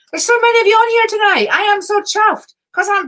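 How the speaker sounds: high, loud and fast burst of delight; thrilled squeal of real excitement; excited. Real, not acted. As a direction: gush about how many are watching. Excited at many viewers joining.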